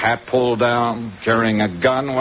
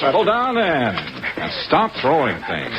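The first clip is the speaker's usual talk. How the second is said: heated struggle; 4 semitones higher than usual. Acted. Struggle continues.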